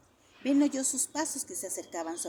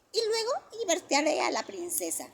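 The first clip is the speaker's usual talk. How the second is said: high, loud, slowing to a triumphant finish; triumphant shout; 11 semitones higher, 6 dB louder than usual. A narrator's reading. Johnny announces he will be king, triumphant child shout. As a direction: crow it like a winner. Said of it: "gritó triunfalmente".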